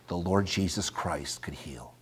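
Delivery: hushed whispered reverence; soft awed whisper. Real, not acted. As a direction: drop to a whisper on the name. Sudden whisper in sermon.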